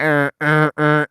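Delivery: loud repeated alarm buzzes; imitated fire alarm. Student retelling the dorm drill, mimicked alarm buzzer. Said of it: "it did the fire drill sound".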